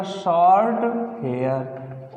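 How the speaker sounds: very slow, drawn-out short hair; slow dictation. Shown as a demonstration. Teacher dictating short hair very slowly. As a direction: stretch out short hair for the class. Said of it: "क्या लिख दिया बेटा".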